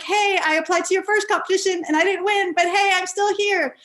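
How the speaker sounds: high, bright-eyed rush of words to her idol; excited greeting. Retold or imitated.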